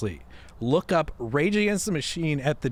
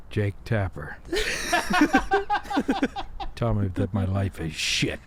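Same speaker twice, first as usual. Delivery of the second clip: gravelly news anchor voice; 4 semitones lower than usual. Acted. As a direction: deliver it like a grim anchor. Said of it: "Joe, that voice is superb".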